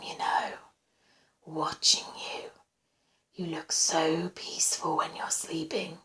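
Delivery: slow, hushed adoration; obsessive whisper. A narrator's reading. Stalker watching her sleep, adoring whisper. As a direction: whisper it slowly, lingering on her.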